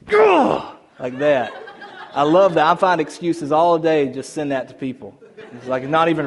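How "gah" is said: sudden loud, high-pitched "Gah!"; imitated "Gah!" outburst. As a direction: burst out with an exaggerated high yell. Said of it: "and then he goes".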